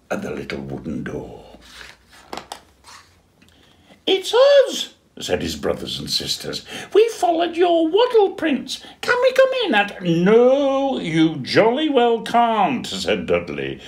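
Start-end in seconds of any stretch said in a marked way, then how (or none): 4.1-4.9 very high, loud, squeaky sisters; tiny high sister penguins calling. Acted. Siblings at door again. 6.9-10.0 very high, loud, squeaky sisters; tiny high sister penguins calling. Acted. Siblings ask again. 10.0-12.9 high, loud indignant refusal, slow on jolly well; huffy penguin shutting them out; cross penguin. Acted. Dudley refuses again.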